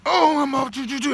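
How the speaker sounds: high, loud burst, like launching off the line; excited sprint start. Retold or imitated. Mimics himself sprinting off.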